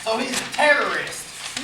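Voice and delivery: high, loud, slowed disbelief; shocked exclamation. Acted. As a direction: gasp it out. Woody shocked at the news.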